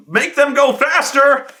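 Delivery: ordinary talk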